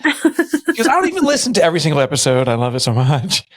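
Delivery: high mock wife, teasing; imitating wife. Husband mimicking wife's teasing about the show. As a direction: lightly voice the wife's sarcasm.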